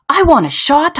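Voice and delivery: high, loud, excited demand; child jumping at the offer; eager kid. Acted.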